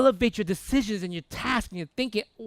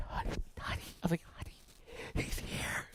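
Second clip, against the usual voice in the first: hushed, voiceless whisper to his wife; awestruck whisper; 3 semitones lower, 14 dB quieter than usual. Retold or imitated. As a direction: whisper it as if the idol just appeared. Starstruck husband alerts his wife.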